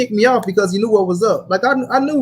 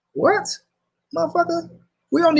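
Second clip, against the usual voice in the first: incredulous what; 8 semitones higher than usual. Real, not acted. Incredulous reaction to the quote.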